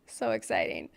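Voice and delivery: low, deadpan irony; dry sarcasm. Real, not acted. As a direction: drop the voice low, mock enthusiasm. Sarcastic remark on grim situation.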